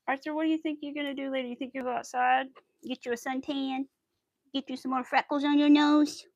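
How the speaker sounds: teasing sing-song questions. Real, not acted. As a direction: talk sweetly to a little one. Talking to Arthur in a cooing voice.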